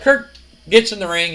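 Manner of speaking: plain reading voice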